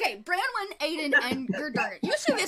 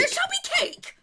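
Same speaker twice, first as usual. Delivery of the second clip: very high, loud insistence; indignant shout; 4 dB louder than usual. Acted. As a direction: top your rival in a squeaky shout. Escalating argument with rival.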